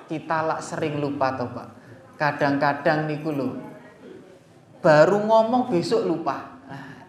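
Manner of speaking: ordinary talk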